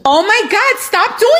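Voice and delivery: high-pitched shriek. Acted. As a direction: cry out in alarm. Caller shrieks at horn.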